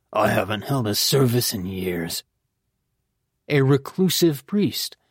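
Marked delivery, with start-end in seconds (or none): 0.1-2.2 low, understated scoff. A narrator's reading. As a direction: answer dismissively, pitched down. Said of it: "He scoffed".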